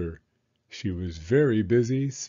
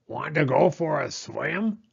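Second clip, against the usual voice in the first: quacky, raised duck voice; 4 semitones higher than usual. Acted. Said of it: "called the duck".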